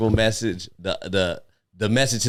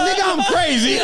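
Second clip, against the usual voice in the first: high, laughing mock voice of the man; imitating the homeless guy. Mocking the homeless man's line while laughing. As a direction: ham up a crazy man's claim through laughter. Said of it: "he goes".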